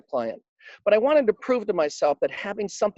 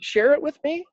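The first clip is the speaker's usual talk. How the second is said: higher, rising, mock incredulous; a touch of stunned seatmate; disbelieving question; 9 semitones higher than usual. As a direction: imitate the stranger who can't believe it. Stranger's disbelief, voiced in story.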